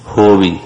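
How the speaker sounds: ਹੋਵੀ said short as ho-vee with a falling end; wrong pronunciation. Shown as a demonstration. The teacher repeats the mistaken ਹੋਵੀ for the class. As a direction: clip ਹੋਵੀ into two quick syllables. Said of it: "ਜੇ ਹੋਵੀ ਕਹਾਂਗੇ".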